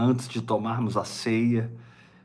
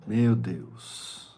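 ordinary delivery

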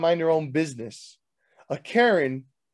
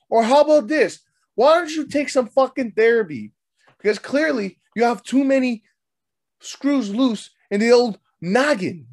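high-pitched, worked-up scolding; indignant rant; 6 semitones higher, 3 dB louder than usual. Acted. Man telling off the woman who insulted his generation, indignant. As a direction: lay into them, voice pitched up.

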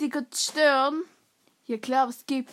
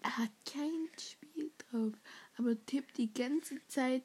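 whispering; 11 dB quieter than usual. Real, not acted. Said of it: "whispered gossip".

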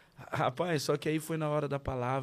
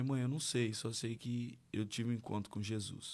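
soft, moved; 7 dB quieter than usual. Retold or imitated. Conta à mãe, comovido.

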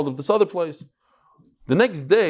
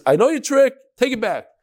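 sharp, higher, louder accusation; furious; 7 semitones higher, 3 dB louder than usual. Retold or imitated. Plumber accusing the supply boss of a scam, furious. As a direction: snap it back at him like you've caught the trick. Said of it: "So he's furious".

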